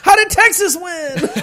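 high shouted disbelief; mock outrage. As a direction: cry out like a stunned sore loser. Acting outraged Boston survey voters.

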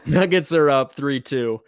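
sudden recall. Real, not acted. Recalls the forgotten matchup.

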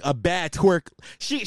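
ordinary talk, nothing marked

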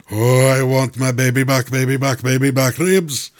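hammed-up Scottish accent; Fat Bastard. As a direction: do a commercial-grade Scottish accent, played for laughs. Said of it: "do a couple lines of fat bastard".

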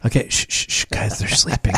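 whispering. Acted. Kids sleeping, hushing.

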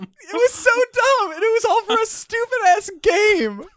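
high, loud, half-laughing outcry; loud incredulous shout. Real, not acted. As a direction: shout it out in disbelief.

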